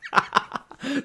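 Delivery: a high burst of real laughter. Real, not acted. Streamer cracking up at a Scottish clip, laughing.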